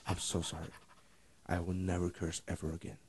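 very soft, low, chastened parrot; small hushed voice, acting contrite; meek apology. Parrot let out of the freezer apologising, meek and hushed.

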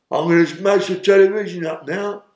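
mock the drugged man announcing he'll smash the TV; imitating drugged inmate. Imitating George in TV room.

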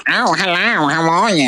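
exaggerated goofy greeting; off-putting phone voice. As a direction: put on a comically awful voice to say hello. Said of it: "if they sounded like".

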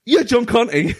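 high-pitched retold greeting, half laughing; excited recognition. Podcaster retelling running down to his old friend, excited. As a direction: call it out high, as if spotting a long-lost friend.

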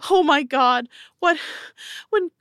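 high, tumbling, overwhelmed parent; frantic relief. Acted. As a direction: pour it out high and distraught. Both parents babble in overwhelmed relief.

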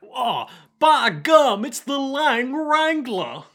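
put-on slow, high old-timer drawl; old prospector. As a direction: cry out like a startled old prospector. Hammed-up old-timer exclamation.

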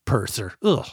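usual speaking voice

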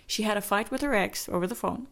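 usual speaking voice

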